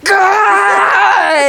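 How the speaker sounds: high, drawn-out "gah" shriek; imitated yell of fright; startled mock scream. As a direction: scream as if something lunged at you. Retold fright at the eel.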